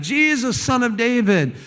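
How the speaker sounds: high-pitched mock cry. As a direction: call out like the man by the road. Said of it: "he's crying out".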